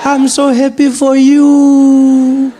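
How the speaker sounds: put-on a delighted old granny drawing it out; grandmother voice. Grandmother on the phone with Trevor, delighted, high and slow. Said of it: "she's like".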